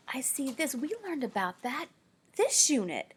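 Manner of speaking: ordinary delivery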